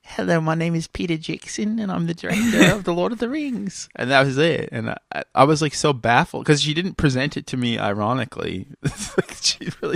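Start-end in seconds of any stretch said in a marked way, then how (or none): 0.0-3.9 a bad impression, pitched higher and mostly her normal voice; mimicking his friend's lame celebrity impression; imitating Peter Jackson. Lightly voiced friend doing a bad Peter Jackson impression. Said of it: "Oh, I can do a really good Peter Jackson".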